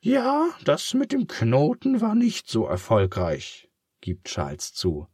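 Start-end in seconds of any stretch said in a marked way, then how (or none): 0.0-3.7 comforting pig. Acted. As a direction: cheer up your friend in a high pig voice. Charles admits.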